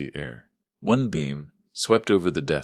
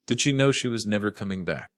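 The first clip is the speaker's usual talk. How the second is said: plain reading voice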